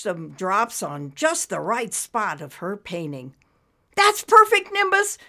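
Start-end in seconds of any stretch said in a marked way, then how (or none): 4.0-5.2 high, loud cheer; delighted, high-pitched praise. Acted. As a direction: cheer your friend on with delight. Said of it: "Esmeralda cheered".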